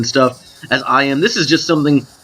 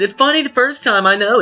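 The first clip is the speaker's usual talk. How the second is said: squeaky voice; 9 semitones higher than usual. Pretend. Squeaky repeat of crack.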